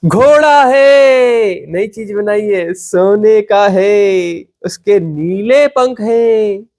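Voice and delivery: hammed-up grand announcement, vowels drawn very long; mock boasting. Teacher imitating a seeker who claims a new invention, mock boasting. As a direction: mimic a proud inventor, stretching each phrase out. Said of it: "तुम कहते हो, "नहीं जी, मैंने एक चीज बनाई है।".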